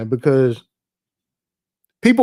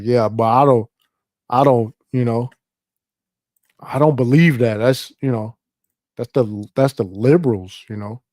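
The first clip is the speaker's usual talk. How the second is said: low, hesitant mock excuse; evasive partisan; 6 semitones lower, 4 dB quieter than usual. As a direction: mumble a lame denial, pitched down and trailing off. Voicing an evasive partisan's weak reply.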